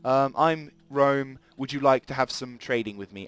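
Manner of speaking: a high, bright "Hi" with a slow, chirpy "I'm Rome"; cheery diplomat greeting. Pretend. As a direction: play the overly friendly envoy. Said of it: "whereupon he can say".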